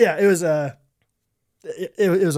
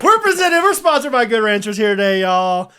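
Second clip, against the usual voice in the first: loud, high announcer hype; mock sponsor announcement; 5 semitones higher, 5 dB louder than usual. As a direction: announce the sponsor like a rodeo host.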